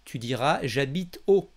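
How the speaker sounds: ordinary talk, nothing marked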